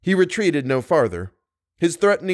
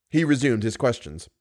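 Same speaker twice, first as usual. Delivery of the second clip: usual speaking voice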